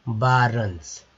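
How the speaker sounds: बारंस as "BAA-runs"; model pronunciation. Shown as a demonstration. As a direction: say बारंस with "uh" in the second syllable. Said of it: "अब मैं आपको बताता हूँ".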